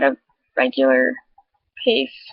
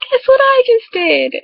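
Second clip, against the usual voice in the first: high, eager teaser; excited news; 12 semitones higher, 4 dB louder than usual. Retold or imitated. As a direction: burst with news for a friend. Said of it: "I just called them and I was like".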